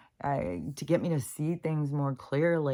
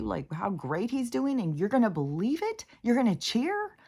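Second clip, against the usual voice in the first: pitched much higher, outraged disbelief; exasperated rant; 8 semitones higher than usual. Real, not acted. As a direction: rant like you can't believe what you're seeing. Exasperated rant about cheering crowds.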